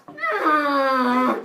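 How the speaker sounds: high, loud hammed-up scream; long mock howl of fury; rage scream. Hera hears of Zeus's baby, screaming in mock rage.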